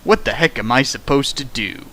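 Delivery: normal talking voice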